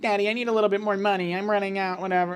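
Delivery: mock spoiled-daughter voice; daddy's girl. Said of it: "like, a total daddy's girl".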